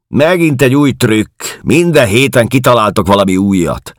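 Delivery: high, loud scoffing; scornful disbelief. A narrator's reading. Woman dismissing a stranger's new trick, scornful. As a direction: throw his story back at him loudly.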